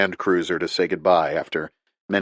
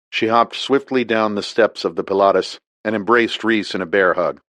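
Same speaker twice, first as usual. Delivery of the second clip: ordinary delivery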